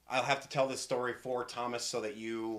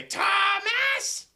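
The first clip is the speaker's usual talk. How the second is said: high-pitched, loud scolding mom; shrill-high motherly holler; angry mother's shout; 8 dB louder, slower than usual. Acted. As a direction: yell for your boy like a cross mother. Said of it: "you hear a female voice".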